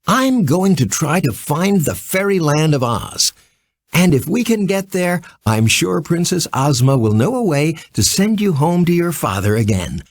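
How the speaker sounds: a lightly voiced little girl offering help; Dorothy. A narrator's reading. Dorothy comforting Polychrome.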